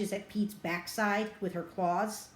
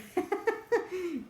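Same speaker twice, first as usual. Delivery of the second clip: real laugh; 11 semitones higher than usual. Real, not acted. Teller laughs at her own scene.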